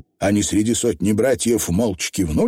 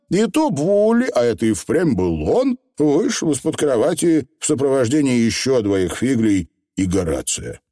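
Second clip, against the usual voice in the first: ordinary delivery, nothing marked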